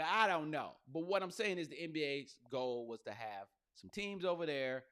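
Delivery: ordinary talk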